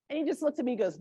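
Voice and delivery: ordinary talk, nothing marked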